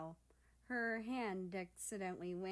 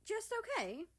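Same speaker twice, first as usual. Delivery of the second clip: much higher, prodding question; a light high prying voice; 14 semitones higher, 3 dB louder than usual. Acted. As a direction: poke at it, pitched way up. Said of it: "Japan pried".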